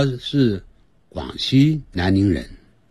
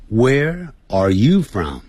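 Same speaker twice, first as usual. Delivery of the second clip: slow, with "you" raised; stress on YOU; 3 semitones higher, slower than usual. Shown as a demonstration. Teacher models where to raise the voice, stress on "you". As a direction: lift the voice clearly on "you". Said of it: "声音要提高".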